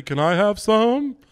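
high, slow, pleading rabbit; loud, drawn-out hopeful question; cartoon rabbit. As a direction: beg like a cereal-ad rabbit. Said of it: "the commercial with the rabbit".

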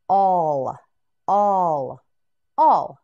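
all called out loud and clear; loud model word. Shown as a demonstration. Teacher models all for students, loudly. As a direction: belt out all for the class to copy. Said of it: "Can you say it?".

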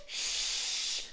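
long, hissed hushing sound; long shush. A narrator's reading. Busy Dad cuts Daisy off, shushing. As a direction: shush an interrupting child.

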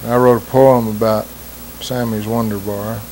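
ordinary delivery, nothing marked

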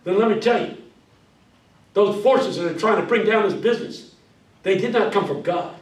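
loud, high revival preaching; Pentecostal preaching. Shown as a demonstration. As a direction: preach it hard, pulling answers from the crowd.

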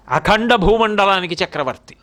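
usual speaking voice

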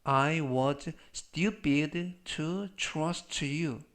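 very slow, word-by-word delivery; slow model reading. Shown as a demonstration. Teacher models the trust sentence for learners, slow model reading. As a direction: read slowly so learners can repeat it.

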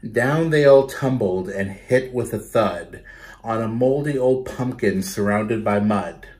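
put-on lively, rhythmic read-aloud for kids; storytelling to children. Teacher reading a picture book to her class, lively read-aloud. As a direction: bounce the rhymes for a class of children.

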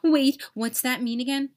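plain reading voice

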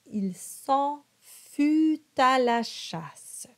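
slow, careful French: fut with a rounded U; French model line. Shown as a demonstration. Coach models the French line slowly for singers. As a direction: spell out each word of the line slowly. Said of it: "Fut is a U sound".

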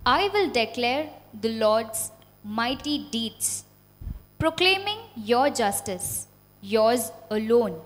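ordinary delivery

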